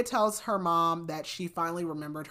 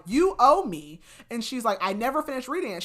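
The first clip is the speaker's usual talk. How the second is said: higher, louder, fast upset plea; upset outburst; 6 semitones higher, 6 dB louder than usual. Retold or imitated. Claudia pleads with the detective, upset and louder. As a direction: push it out like a grieving teen demanding.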